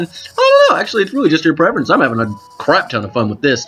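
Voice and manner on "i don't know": squeaky high voice. Pretend.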